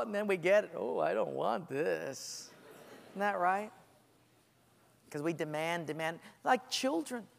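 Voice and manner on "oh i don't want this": slow, deflated letdown; disappointed. Retold or imitated. Believer regretting the answered prayer, deflated. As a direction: sag with disappointment.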